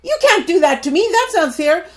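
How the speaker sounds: high, loud, indignant whine; mock self-pitying protest; whiny complainer. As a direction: whine about your rights like a spoiled child. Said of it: "sit there and say".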